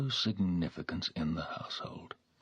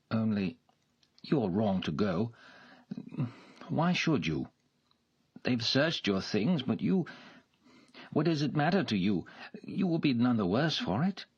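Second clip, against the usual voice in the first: higher, louder, coaxing gentleman; pleading gentleman; 6 semitones higher, 3 dB louder than usual. A narrator's reading. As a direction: talk her out of leaving. Nikolai voiced, pleading.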